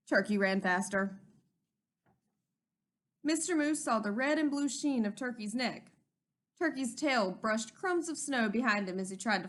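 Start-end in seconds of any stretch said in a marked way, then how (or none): none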